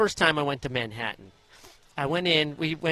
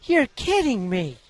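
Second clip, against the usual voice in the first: slow, higher and louder, in stunned disbelief; 9 semitones higher, 6 dB louder, slower than usual. Retold or imitated. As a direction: draw it out like a mother who can't believe it. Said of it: "She goes".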